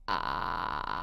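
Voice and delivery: low creaky vocal fry. Shown as a demonstration. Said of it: "você pode usar a vogal A".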